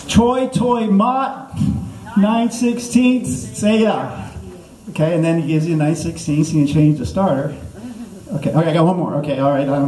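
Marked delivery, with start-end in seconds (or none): 0.1-4.3 very slow, high-pitched syllables; mock language-lesson recitation. Shown as a demonstration. Comic teaching the crowd Vietnamese, slow high recitation. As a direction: draw out each word for the class.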